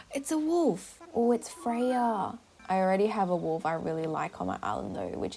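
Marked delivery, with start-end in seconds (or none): 0.0-2.4 gasp, then high, drawn-out words; slow, high-pitched coo of discovery; pleasant surprise. Real, not acted. Gasps at wolf villager.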